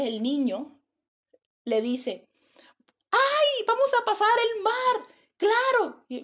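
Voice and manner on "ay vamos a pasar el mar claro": high, loud, eager shout; excited child's cry. Acted. Child telling the woman they will cross the sea, excited high cry. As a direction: exclaim it like a thrilled child.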